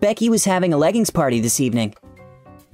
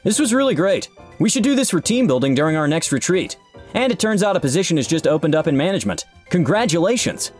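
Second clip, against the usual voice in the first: higher, upbeat praise; tone turned cheerful; 6 semitones higher than usual. A narrator's reading. Boss offering Jacob a management position, suddenly delighted. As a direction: switch to delighted congratulations. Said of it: "his tone changing completely".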